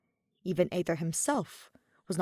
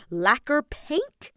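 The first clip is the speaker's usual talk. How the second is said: slow, higher mocking drawl; sarcastic; 8 semitones higher, 6 dB louder, much slower than usual. A narrator's reading. Aether mocking the painting idea, slow and sarcastic. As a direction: drag it out with scorn.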